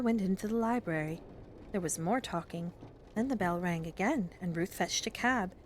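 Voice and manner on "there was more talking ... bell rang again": ordinary delivery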